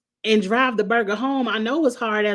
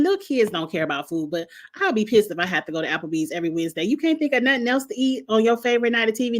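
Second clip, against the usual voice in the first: ordinary talk, nothing marked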